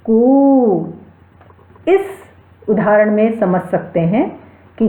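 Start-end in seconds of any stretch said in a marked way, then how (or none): 0.1-0.9 कू drawn out for three beats like a rooster crowing; prolonged pluta vowel. Shown as a demonstration. Teacher imitates the rooster's crow to show a pluta vowel. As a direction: hold कू as long as a cock's crow. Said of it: "हम कुक्कुट के".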